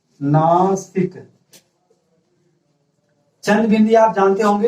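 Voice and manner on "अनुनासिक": अनुनासिक stretched very slow, syllable by syllable; slow drawn-out term. Shown as a demonstration. Teacher answering her own question to students, slow and drawn out. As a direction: draw out अनुनासिक slowly for the class to repeat. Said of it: "क्या है?".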